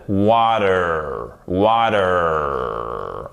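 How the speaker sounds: American "water", long heavy final R; nasal American accent. Shown as a demonstration. Teacher showing the strong American R, nasal American accent. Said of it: "qui a un accent nasal".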